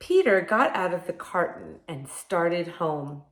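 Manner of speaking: lively storybook read-aloud; reading to children. Acted. Reading picture book to children.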